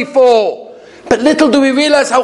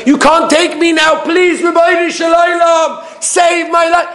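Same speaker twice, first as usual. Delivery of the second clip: desperate plea; 8 semitones higher than usual. Retold or imitated. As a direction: beg for your life at full voice. Dying man pleads with God.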